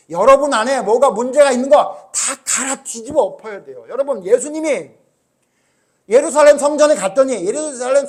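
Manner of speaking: loud, high, urgent sermon; full-force preaching. Acted. Pastor telling how Jesus overturned the temple tables, full-force preaching. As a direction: drive it home to the whole congregation.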